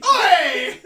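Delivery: loud, high holler with a laugh; mock shout. Co-host copying the reader's dramatic shout, mock yell.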